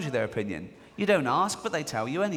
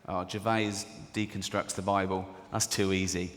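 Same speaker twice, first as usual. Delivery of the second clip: lower, dismissive critic's voice; snooty critic; 7 semitones lower than usual. Retold or imitated. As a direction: sniff it off as beneath you. Quoting religious critic sniffily.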